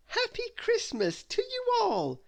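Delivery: very high, slow, joyful greeting; cheerful cry. Acted. Little Miss Sunshine greeting her friends, joyful and high. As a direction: sing out a happy welcome. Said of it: "cried little Miss Sunshine".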